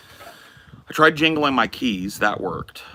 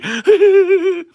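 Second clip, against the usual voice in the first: high burst of laughter; 8 dB louder than usual. Real, not acted. Loud laugh ending bit.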